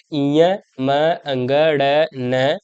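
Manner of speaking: इयम अंगण न drawn out very slowly, each nasal held; slow demonstration of the nasal sounds; slow nasal demo. Shown as a demonstration. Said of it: "आप जब बोलोगे तो आपको देखो".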